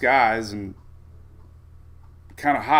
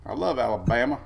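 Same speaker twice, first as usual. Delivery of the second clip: normal talking voice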